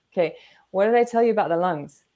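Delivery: ordinary talk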